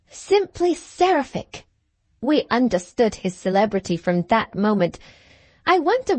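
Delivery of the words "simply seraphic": higher, slow gushing lady; swooning young lady. Retold or imitated. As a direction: sigh it like a smitten girl. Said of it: "as a young lady said behind me".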